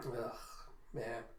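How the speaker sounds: usual speaking voice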